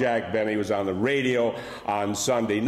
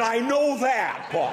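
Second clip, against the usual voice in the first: higher, light Jack Benny deadpan; 9 semitones higher than usual. Retold or imitated. Wife deadpans to Paul Revere in a higher Jack Benny voice. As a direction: play it as Benny's exasperated put-down. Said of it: "who talked like Jack Benny".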